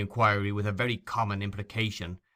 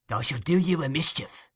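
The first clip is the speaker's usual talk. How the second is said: goaded threat; 5 semitones higher, 3 dB louder than usual. Acted. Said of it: "goaded by these reproaches".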